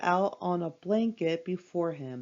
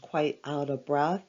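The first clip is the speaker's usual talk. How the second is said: plain reading voice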